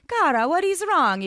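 put-on high, loud, wounded accented plea; hammed-up hurt lover; pleading. A narrator's reading. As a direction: plead with her, voice lifting. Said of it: "Ivan pleaded".

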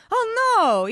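high, loud cry of dismay; hammed-up horror; horrified. A narrator's reading. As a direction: wail it out in alarm. Ivan horrified at the broken tooth.

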